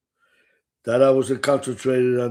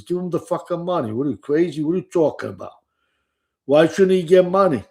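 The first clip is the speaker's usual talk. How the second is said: fast, higher, indignant gamblers butting in; excited protest; 5 semitones higher, much faster than usual. Retold or imitated. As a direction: rattle it off higher, outraged on his behalf. Gamblers protesting at poker table.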